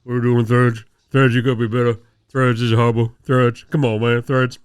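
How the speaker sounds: low, loud mumbled [name] rant; [name] impression. Podcast host imitates [name] ragging on Threads, low and loud. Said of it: "'cause [name] is like".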